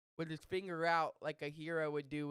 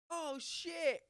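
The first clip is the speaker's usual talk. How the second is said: high, drawn-out mock astonishment; retold amazement; 14 semitones higher than usual. Friends spotting the melon man in the crowd, amazed. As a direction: act out a startled, thrilled reaction. Retelling friends' surprise at his return.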